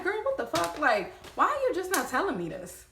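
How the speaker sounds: put-on high, pressing girl what the fuck; exasperated. Confronting the pregnant girlfriend, high exasperation. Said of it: "I'm like".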